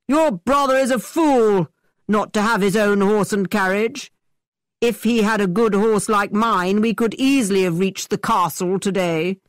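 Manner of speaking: mock her brother with swagger; boastful scorn. A narrator's reading. Thorpe boasts.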